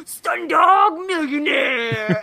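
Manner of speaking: slow, harsh stage whisper. Retold or imitated. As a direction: hiss it out slowly, barely contained. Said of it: "a really harsh stage whisper".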